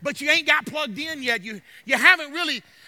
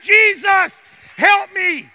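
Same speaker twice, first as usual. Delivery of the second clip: loud, high shouted plea; full-force cry from the pulpit; 6 semitones higher, 11 dB louder, much slower than usual. Acted. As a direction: shout for help to Jesus. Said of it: "they cried out to Jesus".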